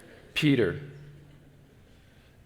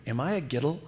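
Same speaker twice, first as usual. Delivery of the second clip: third grader; 2 semitones higher, 4 dB quieter, faster than usual. Retold or imitated. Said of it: "I'm like third grade".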